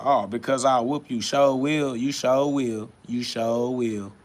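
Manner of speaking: slow, drawn-out mocking repetition; sarcastic sing-song parroting of the commenter; mocking quote.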